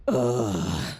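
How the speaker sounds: ordinary talk, nothing marked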